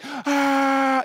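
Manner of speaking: long, high held "aah"; imitated fan heckling a player; taunting crowd yell. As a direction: sustain a jeering yell. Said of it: "we would just yell".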